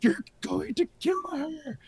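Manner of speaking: high, slow horrified "You're going to kill her"; horrified warning. Retold or imitated. Warning the TV father, horrified and high. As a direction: drag it out in mock horror. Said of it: "I'm like".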